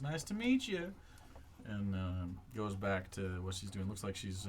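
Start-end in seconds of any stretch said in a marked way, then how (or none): 0.0-0.9 high friendly farewell; Sally saying goodbye to the travellers, higher; Sally's voice. Acted. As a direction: say it like a polite neighbour. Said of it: "Sally goes".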